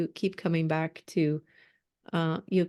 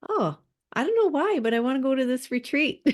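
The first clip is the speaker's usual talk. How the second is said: delighted client; 6 semitones higher, faster than usual. Acted. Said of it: "And then they go".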